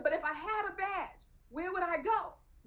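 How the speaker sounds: high-pitched, exasperated insistence; acted pleading, voice climbing high; insistent plea. Retold argument, insisting second time.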